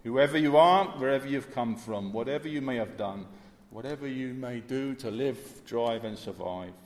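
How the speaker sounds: loud opening that eases into the call; fervent appeal. Acted. Preacher calling every listener to the cross, fervent. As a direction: sweep everyone into the invitation.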